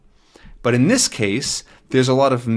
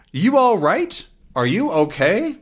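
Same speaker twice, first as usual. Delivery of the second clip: high, worried calling out; mock yell; 9 semitones higher than usual. Pilot checking on Tintin after crash, mock yell. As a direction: call across, lightly acted. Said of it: "the pilot yells to Tintin".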